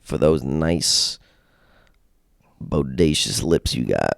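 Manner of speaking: low, slow teasing croon; mock seductive. Friend flirts jokingly about the host's lips, low and slow. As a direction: lay it on thick, slowly.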